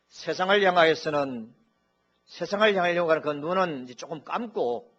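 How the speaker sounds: 설교하듯 크게 외치며; preaching at full force. Shown as a demonstration. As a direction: swell into a preacher's cry on 세상을. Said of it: "preached again loud".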